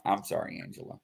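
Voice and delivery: low, soft apology. Real, not acted. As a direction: apologize quietly, voice dropping off on the name. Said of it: "I'll do it this way".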